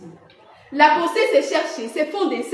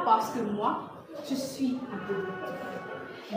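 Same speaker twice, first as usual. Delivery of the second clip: very soft, slow, low final line; slow theatrical fade-out; hushed ending; 6 semitones lower, 12 dB quieter, slower than usual. Acted. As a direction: let the speech fade almost to a whisper.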